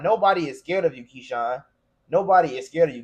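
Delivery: ordinary talk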